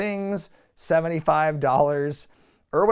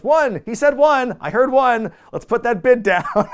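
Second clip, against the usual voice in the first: much higher, much louder gameshow yell; excited host shout; 10 semitones higher, 8 dB louder than usual. Retold or imitated. Host shouts over Jericho, loud gameshow excitement. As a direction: cut him off with a booming host call.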